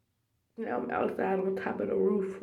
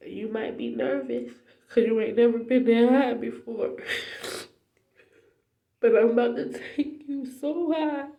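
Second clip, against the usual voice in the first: high, loud tearful cry of testimony; real crying; 5 semitones higher, 5 dB louder than usual. Real, not acted. Passes God's promise on to viewers, crying. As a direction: push the words out through real tears. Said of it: "I'm crying like a big baby".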